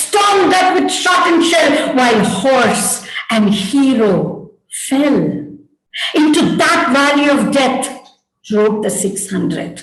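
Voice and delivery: declaimed verse, pitched high; dramatic recitation. Acted. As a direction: proclaim it like heroic stage verse. Recites father's poetry and drama.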